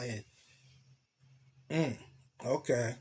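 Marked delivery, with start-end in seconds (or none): none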